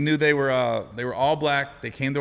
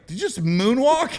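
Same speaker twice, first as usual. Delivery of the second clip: higher, louder, slower disbelief; incredulous coach; 10 semitones higher, 5 dB louder than usual. Retold or imitated. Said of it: "he was like".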